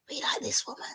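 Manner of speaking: breathy hushed whisper, no voice; secret whisper. Retold or imitated. Boys secretly approving of dad's new partner, whispered. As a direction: whisper it like kids conspiring.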